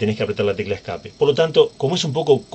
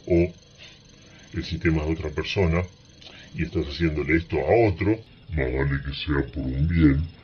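low, hushed cautionary voice; mock-stern warning; 7 semitones lower, 5 dB quieter than usual. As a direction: drop low and soft, as a solemn warning. Advierte sobre usarlo con otros. (advertencia solemne, grave y en voz baja)

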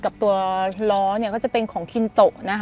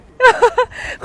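high giggle; 14 semitones higher, 6 dB louder than usual. Real, not acted. Laughs while teasing viewers.

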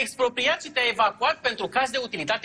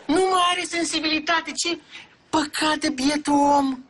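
higher declaiming voice, slowing at the end; 7 semitones higher than usual. Acted. Mayor explaining away the victim's numb hand, showy pity. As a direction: declaim it high for the camera.

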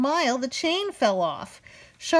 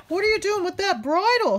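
higher called question; calling out; 9 semitones higher, 3 dB louder than usual. Acted. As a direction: call over to him. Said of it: "he called".

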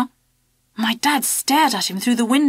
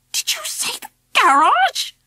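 shocked squeak. A narrator's reading. Dad shocked by garage measuring.

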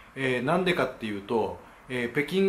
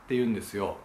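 normal talking voice

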